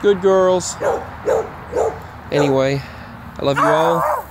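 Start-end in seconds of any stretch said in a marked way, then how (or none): none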